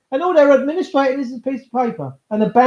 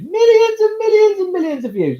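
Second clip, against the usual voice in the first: much higher, squeaky amazed voice; excited exaggeration; 11 semitones higher than usual. Real, not acted. Boasting to viewers about huge views, high and amazed. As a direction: pitch it way up in mock awe.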